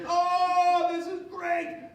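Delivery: a thrilled king showing off; delighted king. Retold or imitated. King on stage to crowd, thrilled shout. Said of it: "he's like".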